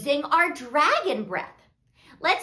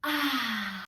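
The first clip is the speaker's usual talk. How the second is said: soft open-mouthed breath out; long exhale; 5 semitones lower, 8 dB quieter than usual. Shown as a demonstration. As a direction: let the air out in a long "ahh". Exhale demonstrated in the story.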